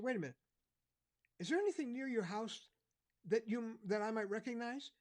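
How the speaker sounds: an officer with a new idea; officer asking a lost boy about landmarks; policeman. Retold or imitated. Policeman voiced in story.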